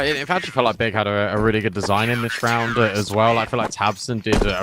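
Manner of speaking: usual speaking voice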